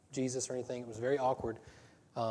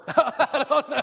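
laughing; 13 semitones higher, 10 dB louder, much slower than usual. Real, not acted. Laughing at own story.

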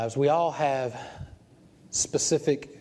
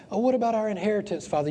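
complaining believer; 8 semitones higher than usual. Retold or imitated.

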